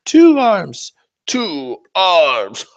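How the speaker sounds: high, loud wail; anguished cry. Real, not acted. Seeing two broken arms on the floor, wailing loud.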